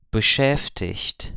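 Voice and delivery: beschäftigt with the final -igt said as -icht; beschäftigt, G pronounced like CH; ch sound for G. Shown as a demonstration. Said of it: "um representado pelo C H e um pelo G".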